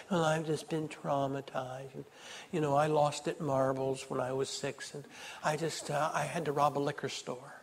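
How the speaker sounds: a mocking, hushed sulky voice; mock whiner. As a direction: sneer at the self-pitying excuse-maker. Mocking a self-pitying complainer.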